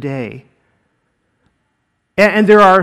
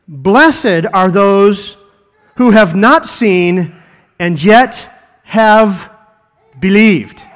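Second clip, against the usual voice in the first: very slow, each word weighed; slow weighty blessing; slow solemn emphasis; much slower than usual. Shown as a demonstration. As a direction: let every word land. Preacher dwelling on key verse.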